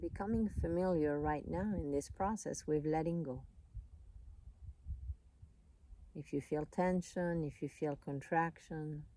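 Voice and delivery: calm, unhurried voice with long pauses; soothing meditation guide, leaving space between phrases; guided meditation. Real, not acted.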